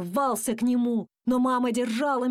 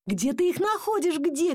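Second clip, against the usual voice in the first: higher-pitched cry, only mildly raised; mild shout; 7 semitones higher than usual. A narrator's reading. Dad questions his son about fleas, mild shout. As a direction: call out in agitation, lightly acted. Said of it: "кричал папа".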